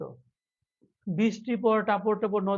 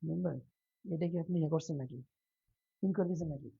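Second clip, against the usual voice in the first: much softer, off-hand murmur; quiet aside; 3 semitones lower, 10 dB quieter, faster than usual. Real, not acted. Teacher asking about a pinned comment, quiet aside. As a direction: drop the volume for a side question. Aside during the live class.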